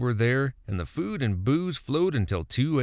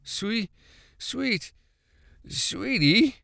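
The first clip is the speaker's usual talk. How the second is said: put-on drunk husband stammers sweetly, higher; stammering; 10 semitones higher, much slower than usual. A narrator's reading. Drunk Buddy stammers to his wife, pitched-up stammer. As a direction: stutter out the pet name, pitched up. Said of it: "Buddy stammered".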